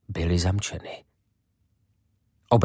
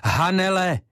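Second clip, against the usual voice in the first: high, loud call of a name; 10 semitones higher, 3 dB louder than usual. Acted. Ivo at the kitchen door, calling Hanele loudly. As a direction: call her name through the locked door.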